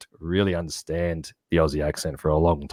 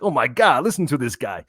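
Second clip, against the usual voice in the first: higher-pitched amazed Brooklyn local; Brooklyn accent; 6 semitones higher than usual. Retold or imitated. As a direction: imitate astonished Brooklyn relatives. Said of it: "And they're like".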